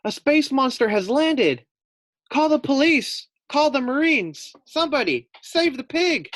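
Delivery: high, loud frantic squealing voice; panicked pig. Acted. Frightened Fluffy shrieks for rescue from the space monster. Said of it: "thought Fluffy".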